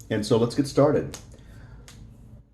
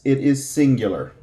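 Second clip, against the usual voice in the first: ordinary talk, nothing marked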